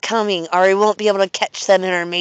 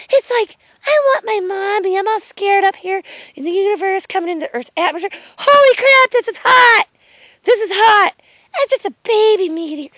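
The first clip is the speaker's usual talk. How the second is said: put-on scared baby meteor; 10 semitones higher than usual.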